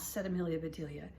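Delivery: gentle read-aloud for little listeners; storybook reading. A narrator's reading. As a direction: read it like bedtime story time. Reading picture book to kids.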